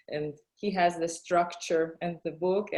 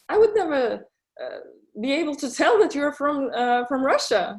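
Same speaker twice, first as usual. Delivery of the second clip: retold amazement, higher and louder, rushing; amazed driver; 6 semitones higher, 5 dB louder, much faster than usual. Uber driver amazed the speaker isn't local, mock-surprised. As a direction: gush as if you can't believe it. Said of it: "And she said".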